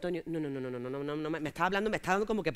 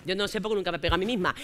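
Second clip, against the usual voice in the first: usual speaking voice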